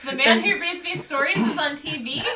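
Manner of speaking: put-on high, loud, gleeful kid; childlike excitement. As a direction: gush like a thrilled kid. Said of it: "And I was like".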